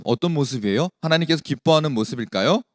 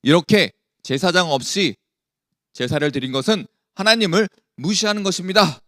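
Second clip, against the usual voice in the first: firm, raised-voice rebuke; angry reprimand; 3 semitones higher, 4 dB louder than usual. Acted. Samuel rebuking Saul for sacrificing without a priest, angry. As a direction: scold with emphatic force, pitch lifted. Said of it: "크게 사울왕에게 화를 내면서".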